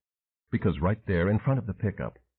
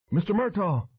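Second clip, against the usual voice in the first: relieved cry; 12 semitones higher than usual. A narrator's reading. Elvis seeing his boss return: high relieved cry. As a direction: cry out much higher in relief. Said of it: "Elvis cried out with relief".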